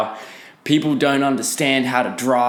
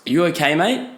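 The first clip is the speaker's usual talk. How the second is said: higher-pitched, rising question; imitated driver checking in, pitched up at the end; concerned check; 6 semitones higher than usual. Driver checks on his passenger after the near crash, concerned.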